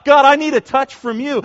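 high, loud plea; pleading. Acted. Worshipper asking God for a touch, loud pleading. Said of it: "I'm just pleading with God".

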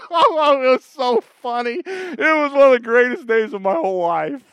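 laughing. Real, not acted. Laughing after the punchline.

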